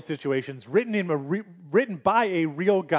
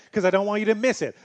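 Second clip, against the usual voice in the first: usual speaking voice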